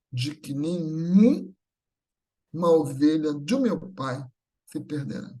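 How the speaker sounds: ordinary delivery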